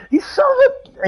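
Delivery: high-pitched re-enacted yell; high mock yell after someone; retold yelling. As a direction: yell it high, breaking off mid-curse. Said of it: "I was yelling out".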